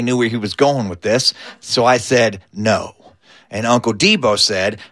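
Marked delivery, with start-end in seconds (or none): 2.5-2.9 low, lightly acted wary reply; a small, lowered admission; wary answer. Camper admits to Skeeter he didn't brush, wary and low. Answering the grave question warily.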